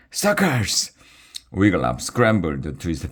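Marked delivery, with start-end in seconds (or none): 0.1-0.9 high, strange mocking shriek; shrieked taunt. A narrator's reading. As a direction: jeer like a different dragon twin. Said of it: "Bilge and Margot shrieked".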